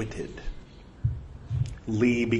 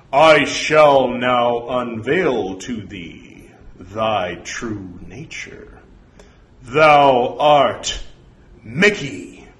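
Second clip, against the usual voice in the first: slow, booming archaic pronouncement; stately, drawn-out proclamation; portentous voice from beyond; 6 dB louder, slower than usual. Acted. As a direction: intone it like a false oracle.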